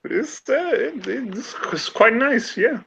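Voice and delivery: put-on a dainty French accent. [name] answering about the drink, high French accent. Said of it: "I keep going German".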